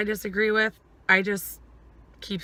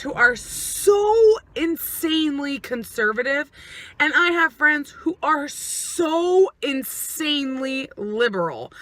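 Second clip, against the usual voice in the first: higher, rising worked-up outburst; heated retold rant; 6 semitones higher than usual. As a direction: vent like you're fed up with everyone. Quoting her heated rant to Kenny.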